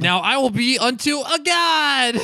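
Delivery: high, hammed-up villain boast; megalomaniac rant. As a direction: squeak it out like a bratty god-king.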